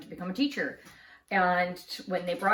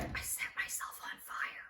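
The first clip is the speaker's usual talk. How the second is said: whispered I set myself on fire, barely audible; aunt whispering the news over the phone; 12 dB quieter than usual. Retold or imitated. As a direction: confess it under your breath. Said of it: "You have to say that louder".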